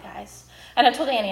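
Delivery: usual speaking voice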